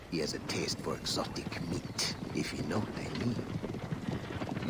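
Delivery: soft, secretive hush; sly whispered insinuation; conspiratorial whisper. Acted. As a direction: lean in and whisper the hint. Merchant hints at secret.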